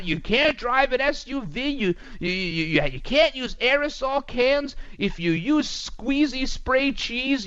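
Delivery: normal talking voice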